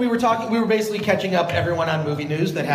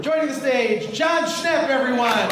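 announcer voice; 7 semitones higher than usual. Real, not acted. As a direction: hype up the guest for the crowd. Host introducing guest to crowd.

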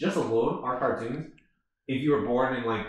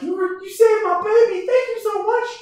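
high, gushing squeal of thanks; grateful mother; 6 dB louder than usual. Retold or imitated. Mother thanking the hero who brought her baby home, high gushing squeal. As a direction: play an overjoyed mother, far up in pitch. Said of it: "and the mother's like".

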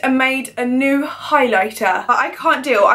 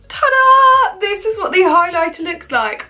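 excited reveal; 5 semitones higher than usual. Real, not acted. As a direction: unveil it with a squeal. Big reveal of the highlighter.

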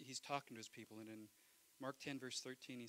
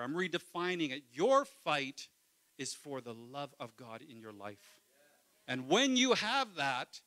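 loud, passionate preacher voice; preacher at full force; preaching; 3 semitones higher, 11 dB louder than usual. Acted. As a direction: preach it hard to the congregation.

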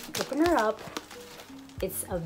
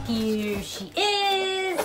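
slow reveal; 10 semitones higher, much slower than usual. Real, not acted. Reveals the bag.

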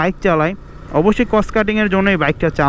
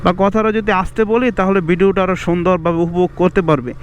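ordinary talk

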